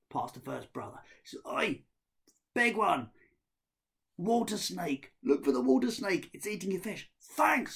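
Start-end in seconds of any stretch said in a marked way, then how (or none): 1.5-7.1 loud, higher shout up the hill; calling out. Acted. Ahmed shouting up to the first brother, loud call. 7.2-7.8 much higher, loud thanks; brother's voice. Acted. First brother thanking Ahmed, high loud voice.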